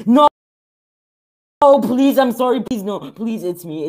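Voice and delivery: high, loud panicked begging, easing down by the end; real game-scare panic, high-pitched; panicked pleading. Real, not acted. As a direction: beg for mercy in a sudden panic.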